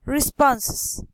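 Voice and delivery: ordinary delivery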